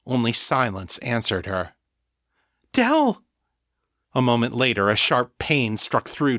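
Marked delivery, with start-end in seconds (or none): 2.7-3.2 very high, anxious call; alarmed cry. A narrator's reading. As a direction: call out for someone who has stopped answering. Sasha cries out for silent Del.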